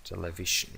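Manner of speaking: soft "Television" repeated; "Television" softly; quiet model. Shown as a demonstration. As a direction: murmur "Television" again. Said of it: "acaba en shon".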